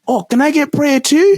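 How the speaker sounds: put-on high-pitched child asking eagerly; imitated little kid. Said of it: "these little kids were like".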